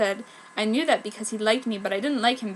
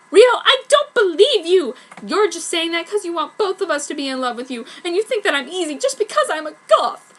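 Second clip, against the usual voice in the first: loud, higher furious outburst; angry accusation; 7 semitones higher, 6 dB louder than usual. Acted. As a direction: lash out at a lying boyfriend. Angry girl accusing boyfriend.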